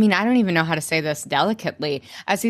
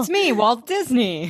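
mock impostor greeting; 5 semitones higher than usual.

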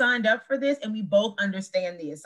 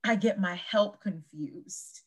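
slow quoting; much slower than usual. Retold or imitated. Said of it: "when Ramona said".

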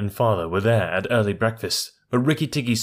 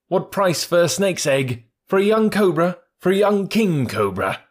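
higher, louder bargaining taunt; gloating mongoose; 9 semitones higher, 4 dB louder than usual. A narrator's reading. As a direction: dangle the egg with glee.

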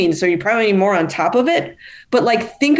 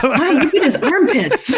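giggling, pitched higher, poking fun; laughing mockery; 10 semitones higher than usual. Real, not acted. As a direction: laugh through a mock gawk. Laughing mock onlooker.

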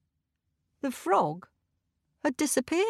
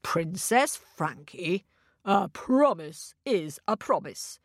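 grave king. Acted. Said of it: "said her dad gravely".

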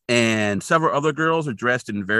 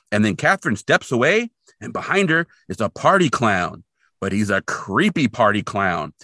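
ordinary talk

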